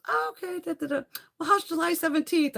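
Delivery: high sing-song scheduler imitation; chirpy receptionist. Voices receptionist booking appointment.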